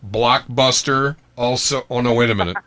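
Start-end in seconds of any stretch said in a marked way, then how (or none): none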